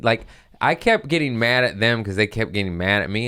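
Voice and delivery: ordinary delivery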